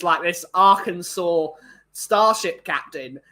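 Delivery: usual speaking voice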